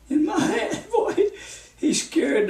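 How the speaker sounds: high laugh breaking through the words. Real, not acted. Preacher laughing as he recalls being rebuked. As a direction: laugh nervously at the scary memory.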